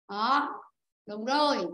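ordinary talk, nothing marked

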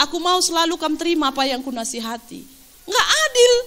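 ordinary talk, nothing marked

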